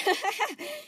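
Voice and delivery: high, nervous laughter; forced laugh. Acted. Spinel covering her hurt, forced laugh. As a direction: laugh it off hard. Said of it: "chuckled nervously".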